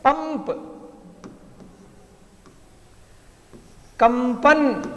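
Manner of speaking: पंप and कंपन said high with a clear m before p; a teacher sounding out पंप, कंपन with m; nasal m sound demo. Shown as a demonstration. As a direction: show the anusvara as m in पंप and कंपन. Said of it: "जब उसको अलग करेंगे तो वह".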